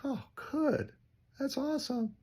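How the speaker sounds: an overly cheerful yes to a client; mock cheery. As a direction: sing it up high like forced cheer.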